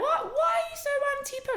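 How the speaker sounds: high, laughing disbelief; quizzing a friend about the pill, amused disbelief; incredulous. Retold or imitated. Incredulous quoted question to friend.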